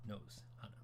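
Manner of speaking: softly whispered gloss; tiny whisper; whispered aside. Real, not acted. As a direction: drop to a whisper for the translation. Quiet translation aside.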